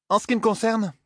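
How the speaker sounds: very high-pitched character voice; haughty Zarine. A narrator's reading. Huntress asks about her own case, high and haughty. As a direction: play a self-assured huntress, pitched right up.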